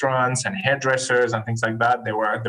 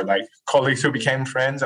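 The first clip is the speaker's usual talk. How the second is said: ordinary delivery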